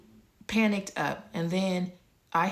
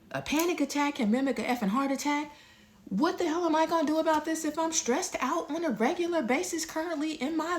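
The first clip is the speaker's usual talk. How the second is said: much higher, incredulous and alarmed; alarmed inner thought; 9 semitones higher than usual. Retold or imitated. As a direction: let the disbelief climb high as it sinks in. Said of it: "I'm thinking in my head".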